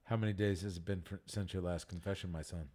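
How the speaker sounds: soft, low priest; quietly asking as a priest; hushed priest voice. Pretend. Priest role in mock confession.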